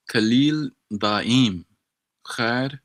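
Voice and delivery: "Khalil daim" spoken slowly, each syllable spaced; slow practice. Shown as a demonstration. Practising the lyric for his tutor, very slow and deliberate. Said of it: "slow practice of phrase".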